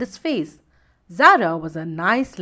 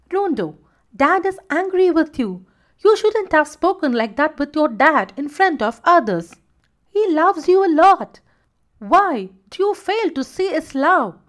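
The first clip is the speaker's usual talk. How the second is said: firm, higher mother scolding; strict; 6 semitones higher, 3 dB louder than usual. A narrator's reading. Mother scolding her son about his father, strict and high. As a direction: set him straight, mother to son. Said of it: "Shinu asked Rondo strictly".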